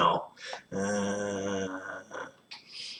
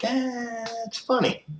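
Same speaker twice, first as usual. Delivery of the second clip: high mock cheer. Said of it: "be like".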